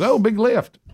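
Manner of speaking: high squealing fan voice; excited fan. Retold or imitated. A fan in the grocery store, high and thrilled. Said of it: "this woman walks by and she goes".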